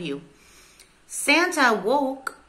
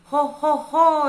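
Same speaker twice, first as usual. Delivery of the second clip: loud, high ho-ho-ho; exclaimed Santa laugh; 5 semitones higher, 6 dB louder than usual. A narrator's reading. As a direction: boom it out like storybook Santa. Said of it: "exclaimed Santa".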